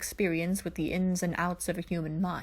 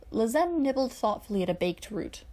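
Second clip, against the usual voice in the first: ordinary delivery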